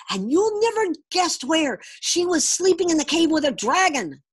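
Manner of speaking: put-on high, loud boyish announcement; excited child telling father. A narrator's reading. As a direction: burst out with the big news. Boy breaking astonishing news.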